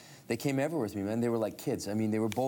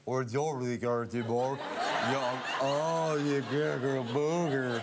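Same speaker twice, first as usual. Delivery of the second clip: drawn-out garbled mock speech; exaggerated gibberish-like babble, vowels stretched; imitating incomprehensible English; 4 semitones higher, much slower than usual. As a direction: slur it into nonsense, as a non-English listener hears it. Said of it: "she doesn't understand the words. She just sees me going".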